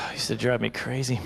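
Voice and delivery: ordinary talk, nothing marked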